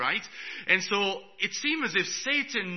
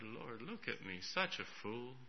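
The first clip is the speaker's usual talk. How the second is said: much softer and lower, rueful; hushed self-rebuke; 6 semitones lower, 15 dB quieter than usual. Retold or imitated. Preacher scolding himself before the Lord, hushed. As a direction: mutter it ashamed under your breath. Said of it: "I said".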